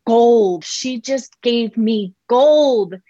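slow, hushed wonder, stretching each word; awed realization. Retold or imitated. Mom marveling to herself at her daughter, slow and awed. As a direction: savor the insight like a treasure. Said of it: "I just sat with it and I was like".

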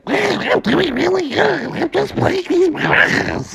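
very high, squawky duck voice; high, garbled cartoon duck; Donald Duck. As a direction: quack the words in a cartoon duck voice.

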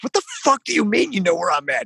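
incredulous. Retold or imitated. Can't believe what he's hearing. Retold disbelief on the phone.

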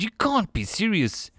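high-pitched exclamation, understated shout. A narrator's reading. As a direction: cry it out in shocked protest, without full volume. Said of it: "He shouted".

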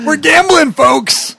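high, loud game-show hype; excited shout. Pretend. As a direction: yell it like a gambling host. Hyping a risky reroll.

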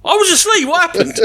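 high, protesting voice; mock innocent protest. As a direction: act baffled and innocent. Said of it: "He's taking the mick out of you".

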